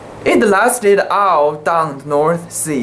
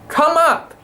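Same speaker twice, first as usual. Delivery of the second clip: calling out; 11 semitones higher than usual. A narrator's reading. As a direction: call up the ladder to the captain. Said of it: "he heard my shout".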